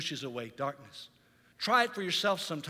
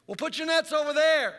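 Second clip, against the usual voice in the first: calling out; 8 semitones higher, 6 dB louder than usual. Acted. Stranger calls to the boat.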